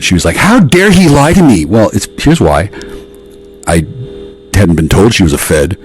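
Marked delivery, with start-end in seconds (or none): 0.4-1.7 high, slow mock indignation; mock-livid, drawn-out protest; imitated livid outrage. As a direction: draw out her outrage in a higher voice. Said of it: "And she was livid".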